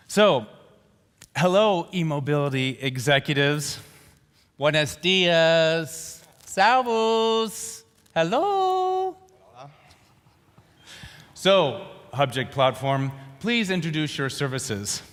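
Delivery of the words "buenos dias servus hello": stretched greetings, each pitched higher; slow showy hellos climbing to a high held note; drawn-out showman greeting. Pretend. Host's drawn-out multilingual greetings.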